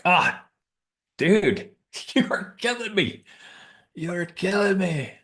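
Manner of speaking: put-on a man moved by a baby's name; emotional high cry. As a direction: cry out, touched and laughing.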